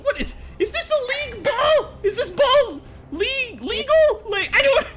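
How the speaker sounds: very high, squeaky goblin voice, stammering in disbelief; a baffled little goblin who can't believe the ball came back; flabbergasted goblin. Acted. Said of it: "catches the ball and goes".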